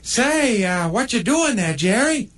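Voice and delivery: sly neighbor pug, high and teasing. Acted. Pug Buzz needles the coned dog, high and cheeky.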